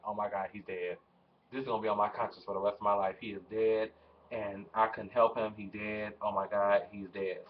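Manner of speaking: hushed, low panicked muttering; low frantic murmur of shock; panicked whisper. Retold or imitated. Panicking, thinks brother is dead.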